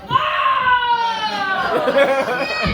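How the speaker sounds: long, high mock squeal of delight; excited squeal. Comic acts out her thrill at mom joining in, squealing.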